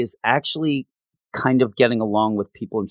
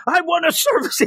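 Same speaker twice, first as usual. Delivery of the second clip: mock scream. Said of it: "he screams".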